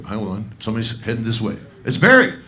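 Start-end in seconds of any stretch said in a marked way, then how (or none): 1.9-2.3 sudden high, loud call of a name; spotting a friend and calling his name out. Real, not acted. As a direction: announce him with a bright shout. Calling out arriving guest.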